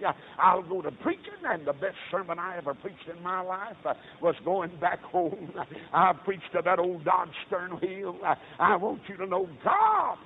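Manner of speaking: rhythmic, drawn-out Southern pulpit delivery; impassioned revival preacher; fervent preaching. Acted. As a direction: preach it in heaving, punched phrases. Preaching at full force.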